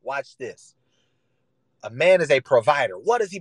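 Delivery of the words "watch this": quiet aside. Acted. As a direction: mutter watch this under the breath. Same phrase said twice, contrasted.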